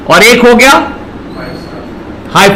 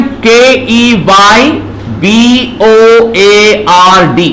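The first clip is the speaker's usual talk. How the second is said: slow letter by letter spelling; spelled out; much slower than usual. Shown as a demonstration. Teacher slowly spelling keyboard letter by letter. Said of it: "teacher spells out keyboard".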